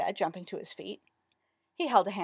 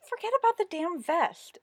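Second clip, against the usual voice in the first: exasperated; 12 semitones higher, 3 dB louder than usual. A narrator's reading. Exasperated with friend's mourning.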